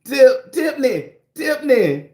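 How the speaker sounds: high, loud sing-song calling of a name; excited high shout-out. Real, not acted. Streamer calling a viewer's name in delight, high sing-song. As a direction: call the name out gleefully.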